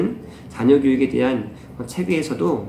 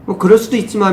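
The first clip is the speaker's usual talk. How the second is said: higher, louder breezy shrug-off; permissive parent; 8 semitones higher, 7 dB louder than usual. Retold or imitated. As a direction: brush it off as a lax parent. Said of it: "어떤 부모님들은". (대수롭지 않게 넘기는 부모 흉내)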